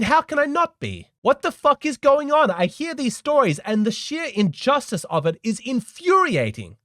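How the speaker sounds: worked up. Real, not acted. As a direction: let the real indignation boil over at the listener. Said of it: "I'm getting worked up. I'm getting emotional".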